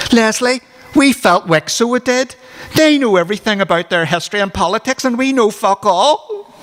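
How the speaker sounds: put-on high, whiny, aggrieved teenage voice; upset teen complaint.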